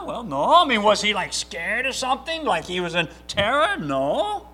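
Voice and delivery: ordinary talk, nothing marked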